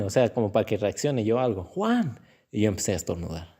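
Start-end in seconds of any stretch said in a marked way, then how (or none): 1.6-2.2 calling out. Retold or imitated. Mother calling the baby.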